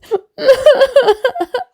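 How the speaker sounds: high wailing sob-laugh; crying laugh. Acted. Boy protesting going indoors, whiny cry. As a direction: let out a childish wail. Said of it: "little Kabir cries".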